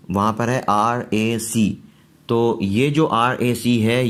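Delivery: ordinary talk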